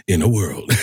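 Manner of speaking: lightly mimicked movie-trailer opening; a hint of trailer announcer; Don LaFontaine. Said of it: "they all started with".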